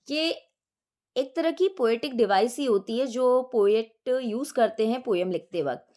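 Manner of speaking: usual speaking voice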